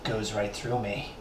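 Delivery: low, slow crime show pun; CSI one-liner. Shown as a demonstration. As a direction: deliver the pun like a TV detective. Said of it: "Can you make a pun out of that, like in, like, C S I?".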